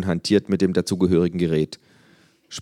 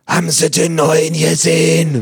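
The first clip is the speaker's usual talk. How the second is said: put-on higher, louder, slow old Berlin busybody; nosy neighbour; 11 semitones higher, 10 dB louder, slower than usual. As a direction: squawk like a gossiping neighbour. Neighbour voiced in story.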